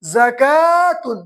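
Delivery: زکاتن read very slowly, za-kaa-tun joined; slow blended word. Shown as a demonstration. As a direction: sound out زکاتن slowly for students. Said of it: "تا دو پیش تن".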